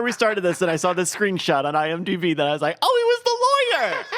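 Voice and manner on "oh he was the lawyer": high, sudden oh of discovery; surprised realization. Retold or imitated. Recalling spotting Teller as lawyer, surprised. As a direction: jump up on the oh as it clicks.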